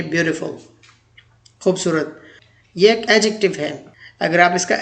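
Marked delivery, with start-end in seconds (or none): 0.0-0.6 ब्यूटीफुल with a soft flapped d in the middle, American style; American English beautiful. Shown as a demonstration. Teacher going back to the American ब्यूटीफुल, soft flapped t. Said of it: "American English में इसे कहेंगे".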